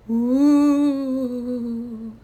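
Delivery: long, loud, hollow ghostly ooh; ghost moan. Acted. Papa as ghost moaning to scare the Scouts.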